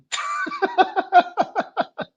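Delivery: giggling at his own rant; high laugh. Real, not acted. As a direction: burst of high-pitched laughter at himself.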